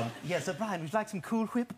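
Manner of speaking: high, prim cartoon voice; cartoon baby Stewie. Actor doing requested routine, Stewie offering dessert, high voice. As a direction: offer it in a Rex Harrison-like character voice. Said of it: "can you do the Cool Whip thing?".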